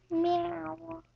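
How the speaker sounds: high drawn-out cat meow. Pretend. Kid answering the cat with a mock meow.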